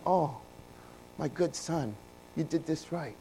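soft, hushed fatherly praise; quiet, tender father praising his son; tender imagined praise. Retold or imitated. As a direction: say it like longed-for gentle words.

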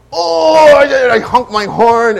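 high, loud road-rage yell, acted out; retold angry outburst. Driver reacting to being cut off, angry retold yell. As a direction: re-enact losing it, loud and high. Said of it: "there was one time I lost it".

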